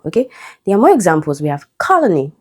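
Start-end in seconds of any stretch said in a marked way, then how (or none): 1.8-2.3 "colony" stressed on COL; first-syllable stress. Shown as a demonstration. Teacher giving more examples, first-syllable stress. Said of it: "stressed on the first syllable".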